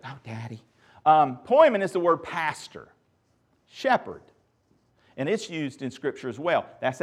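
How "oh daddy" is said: low, hushed mock groan of a kid; kids' groan. Kids reacting to dad's joke, quiet mock groan. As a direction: softly mimic an embarrassed child, pitched down. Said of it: "My kids got that joke".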